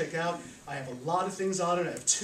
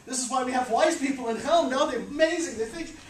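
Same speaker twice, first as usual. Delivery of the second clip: amazed workers; 6 semitones higher than usual. Acted. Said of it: "all of them said".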